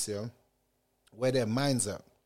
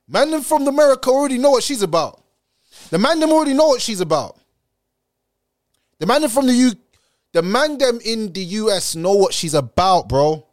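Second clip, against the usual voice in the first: loud ranting; 4 semitones higher, 5 dB louder than usual. Real, not acted. Heated rant.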